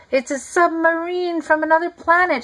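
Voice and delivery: high, panicked coach voice; panicked shriek. A narrator's reading. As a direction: scream it in alarm. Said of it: "shrieked the coach".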